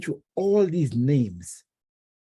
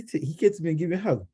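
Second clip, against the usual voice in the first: normal talking voice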